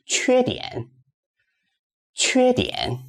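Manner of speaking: teacher modelling the tones of "缺点"; first then third tone. Shown as a demonstration. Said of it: "first tone, third tone".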